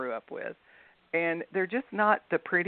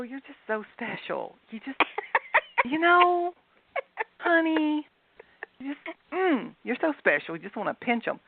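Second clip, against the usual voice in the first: high, sugary mock-sweet voice, lightly done; syrupy Southern sweetness; 8 semitones higher than usual. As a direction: coo it like a Southern aunt being falsely sweet. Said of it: "I explained to her that it really just meant".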